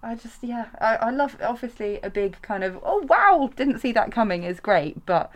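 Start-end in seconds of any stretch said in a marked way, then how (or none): 2.8-4.4 high, loud exaggerated gasp of surprise; mock surprise. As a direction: ham up being shocked. Mock gasp at a plot twist.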